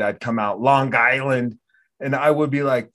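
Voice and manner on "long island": "Long Island" run together as "Lawn Guyland"; hard g into "Island", Long Island accent; mimicked Long Island accent on "Long Island". Shown as a demonstration. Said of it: "when I would say it".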